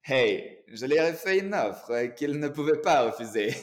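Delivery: hammed-up Italian mafioso line, drawn out; Italian accent. Shown as a demonstration. Coach jokes about his irresistible offer, hammed-up Italian accent. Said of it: "avec mon accent un peu d'origine italienne".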